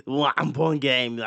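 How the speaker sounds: slow, loud Austrian Arnold voice; play-by-play as Arnold Schwarzenegger with a heavy Austrian accent; slow booming Austrian accent. Pretend. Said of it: "How y'all like my Arnold accent?".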